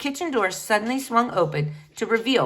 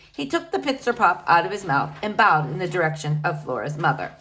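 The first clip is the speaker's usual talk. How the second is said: usual speaking voice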